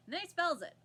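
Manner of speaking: usual speaking voice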